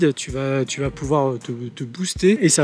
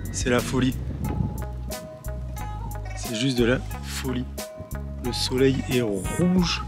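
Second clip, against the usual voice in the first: hushed awe; 4 semitones lower, 7 dB quieter than usual. Real, not acted. Awed at sunrise over clouds.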